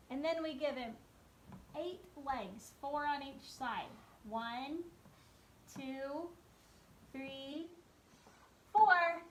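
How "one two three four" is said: put-on teaching a child to count. Shown as a demonstration. Counting legs for kids.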